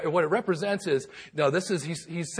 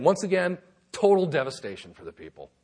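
ordinary talk

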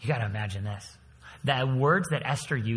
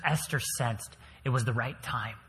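normal talking voice

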